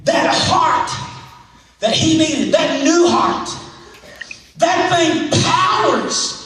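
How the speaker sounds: calling out from the pulpit with rising fervor; full-force preaching. Acted. Preaching over music.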